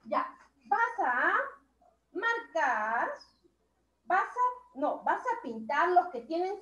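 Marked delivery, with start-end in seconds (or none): none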